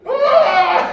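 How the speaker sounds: short hammy scream of fright; mock scream. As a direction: yelp it like a startled sleeper. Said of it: "and then she's like".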